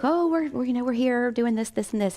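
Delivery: high start, gradually settling; mock-innocent small talk; airy retold reply. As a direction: play it casual in a high voice. Acting her casual answer to him.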